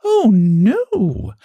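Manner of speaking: sweet as pie, brushing off the jab; high sugary lady's voice; sweetly. Acted. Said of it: "she said sweetly".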